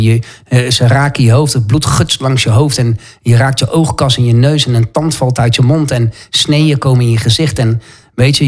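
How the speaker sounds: ordinary talk, nothing marked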